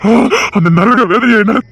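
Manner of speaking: ordinary delivery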